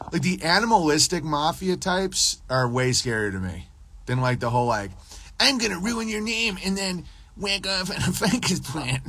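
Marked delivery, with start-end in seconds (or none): 5.4-9.0 high mock threat breaking into laughter. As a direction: put on a high caricature voice, cracking up.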